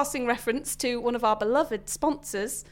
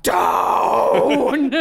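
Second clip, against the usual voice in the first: louder laughing metal yell of "Down!"; 2 semitones lower, 7 dB louder than usual. Retold or imitated.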